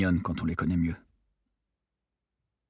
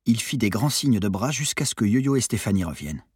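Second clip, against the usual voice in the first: ordinary delivery, nothing marked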